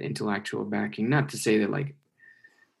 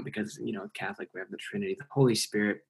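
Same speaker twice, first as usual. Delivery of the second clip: ordinary talk, nothing marked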